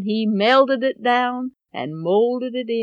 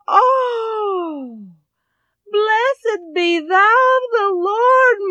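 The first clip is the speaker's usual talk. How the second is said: high, slow, loud overjoyed blessing; relieved blessing; 9 semitones higher, 4 dB louder, much slower than usual. Acted. Mother relieved to learn where her money is, overjoyed blessing. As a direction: pour out relief and joy.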